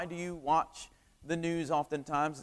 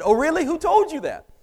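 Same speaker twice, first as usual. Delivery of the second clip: high, loud surprised question; astonished, pitch leaping up; 11 semitones higher, 7 dB louder than usual. Retold or imitated. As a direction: blurt it out as if taken aback. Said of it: "made it sound like I was surprised".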